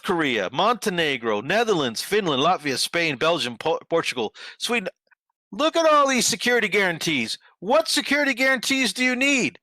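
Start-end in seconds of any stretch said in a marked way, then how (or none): 5.5-9.6 high-pitched incredulous demand; exasperated challenge. Real, not acted. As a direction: throw the question out in exasperation, voice pitched way up. Exasperated challenge to absent Zelensky.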